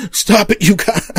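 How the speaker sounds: half-hearted scolding through laughter; laughing teacher. Retold or imitated. A teacher breaking up a fight, laughing as she scolds. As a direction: tell them to stop while chuckling. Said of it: "the teacher was kinda laughing".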